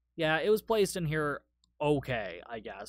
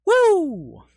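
very high, loud "woo"; excited whoop; 13 semitones higher, 13 dB louder than usual. Real, not acted. Film fan celebrates the shark scene, high excited whoop. Cheering the film's cocaine sharks.

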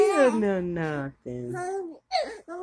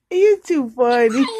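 laughing; 3 semitones higher, 9 dB louder than usual. Real, not acted. Laughing at baby.

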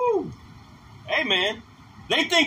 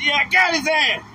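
gleeful celebration; 5 semitones higher, 3 dB louder than usual. Acted. Angels celebrating with high fives.

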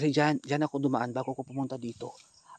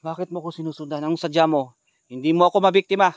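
defiant challenge; 9 dB louder than usual. Real, not acted. Challenging the aswang.

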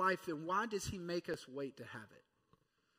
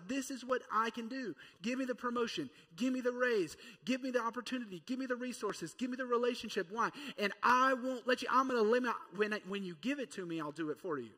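higher, pushy list of wants; eager demands; 6 semitones higher, 5 dB louder, faster than usual. Acted. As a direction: rattle off wants like an eager kid. Eager Peter begging for gifts.

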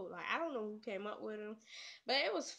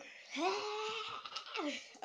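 long, high exasperated noise; frustrated groan; 9 semitones higher, 3 dB quieter than usual. Real, not acted. Player after fumbling a tongue twister, frustrated groan. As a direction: let out a drawn-out high wail of frustration.

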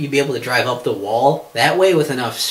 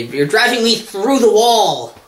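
slow, raised mock quote; mock angry driver; 8 semitones higher, 5 dB louder, slower than usual. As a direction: ham up the driver's complaint.

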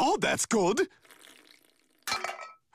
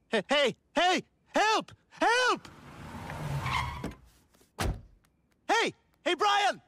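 much higher desperate yells; shouting for help; 10 semitones higher than usual. Acted. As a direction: scream for help from the roof. Stuck on roof calling.

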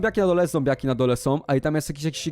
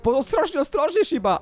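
high, urgent call to be careful; alarmed warning; 9 semitones higher than usual. Real, not acted. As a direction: warn the dog in a high, anxious voice. Warns his dog in the game.

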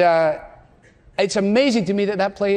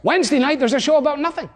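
higher-pitched excited TV fan, acted; mock hype; 6 semitones higher, faster than usual. Everybody hyping a show about nothing, mock excitement. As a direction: talk up the show with mock enthusiasm.

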